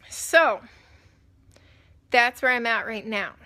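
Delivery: suppressing hiccups. Real, not acted. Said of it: "Sorry, I have hiccups".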